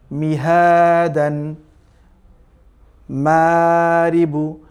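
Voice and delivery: spaced-out Arabic words, slow; careful recitation pace; slow reading demo. Shown as a demonstration. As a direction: sound each word out for the class. Reads Arabic practice words slowly.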